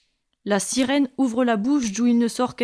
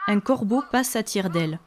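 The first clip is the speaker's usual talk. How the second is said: usual speaking voice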